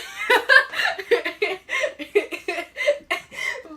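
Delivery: high, loud laughing fit; laughter. Real, not acted. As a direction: burst out laughing mid-sentence. Cracks up mid-sentence.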